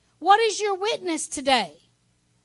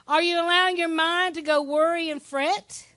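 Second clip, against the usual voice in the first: usual speaking voice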